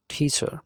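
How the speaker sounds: টিচার repeated with a crisp retroflex ট onset and no final r; English teacher said টিচার, T like ট; teacher with hard T. Shown as a demonstration. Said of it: "আমরা একটু বলবো".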